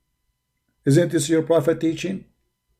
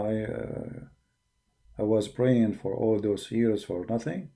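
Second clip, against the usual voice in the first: softer, slow and subdued, imitating a sad man; retold sadness; 7 semitones lower, 9 dB quieter, slower than usual. Convert realizing wasted years of prayer, quiet mock sadness. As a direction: let it sink, quiet and slow like his regret. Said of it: "He was really sad".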